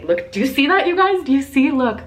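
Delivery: high, loud, thrilled; excited. Real, not acted. Showing her viewers the goosebumps on her arm, excited. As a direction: urge them to look at the goosebumps.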